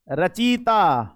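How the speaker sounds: रचयिता stretched very slowly, each syllable held; teacher drags out रचयिता; drawn-out pronunciation. Shown as a demonstration. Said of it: "कुछ लोग लिखेंगे रचयिता". (रचयिता को बहुत धीरे खींचकर बोलना)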